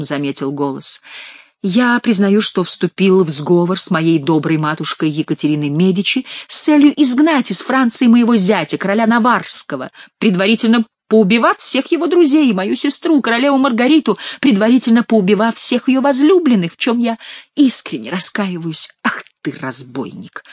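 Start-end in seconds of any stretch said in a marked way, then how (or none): 1.6-18.9 higher, put-on penitent king; hammed-up contrite recital; nasal mock confession. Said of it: "загнусавил Шут". 19.0-20.4 low, quiet seething; hushed, lowered fury; angry through teeth. A narrator's reading. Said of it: "гневно процедил король сквозь плотно сжатые зубы".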